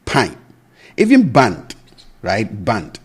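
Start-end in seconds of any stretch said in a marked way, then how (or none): 0.0-0.4 put-on Pine said for pan in a Twi accent. Teacher imitating Twi speakers saying pan. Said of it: "pronounced as pine".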